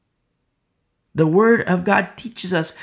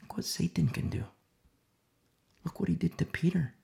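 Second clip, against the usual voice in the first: ominous whisper; 8 dB quieter than usual. Shown as a demonstration. Preacher warning the flock about Satan, whispered. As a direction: hush it like a warning.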